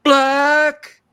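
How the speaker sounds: very high, loud cartoonish yell; imitating George Steele. George Steele yelling in the ring, very high and loud. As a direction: bellow it like a wild wrestler. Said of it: "he'd say".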